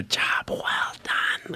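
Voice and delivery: slow, whispered telepathic voice; drawn-out whisper from a strange being; mental voice. Acted. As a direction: whisper it slowly, straight into their minds. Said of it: "in his, like, mental, mental voice".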